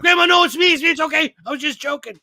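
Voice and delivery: high, pleading retold reassurance, easing off; urgent mock reassurance. Grandson confessing the prank to frightened grandma, urgent acted reassurance. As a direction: start high and loud, then settle down.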